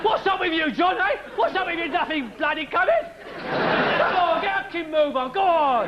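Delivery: high, mocking nag at a stopped car; impatient London driver. Mocking a stopped driver from behind, high jeering nag. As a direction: sneer at the fool who stops at red.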